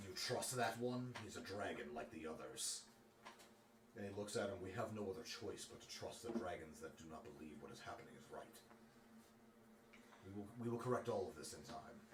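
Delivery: soft, serious talk between comrades; lightly voiced, low grave heroes. Galdrin and Ruithor, low grave debate. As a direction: weigh the risk, quiet and sober.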